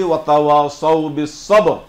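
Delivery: "বিস সবর" slow and loud with a heavy sad; slow correct recitation. Shown as a demonstration. Teacher drilling kids, slow loud "বিস সবর" with sad. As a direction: draw out "ওয়াতাওয়াসাউ বিস সবর", thick স. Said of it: "একবার বলি".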